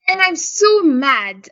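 high, drawn-out indignant complaint; retold exasperation. Woman telling friend the toy let her down, exasperated. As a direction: lean slowly on 'so', pitched well up.

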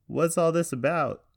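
high, loud heckle from a crowd; yelled question. A narrator's reading. Everett questioning the announcement from the crowd, yelling. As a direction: yell it across the room. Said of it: "Everett Gardner yelled".

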